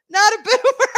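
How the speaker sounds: cracking up at chat's joke; laughing. Real, not acted. Streamer teasing chat about boomers, laughing.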